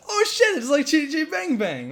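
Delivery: put-on high, loud childlike delight; excited kid. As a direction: squeal it like a thrilled kid. Retelling his childhood excitement.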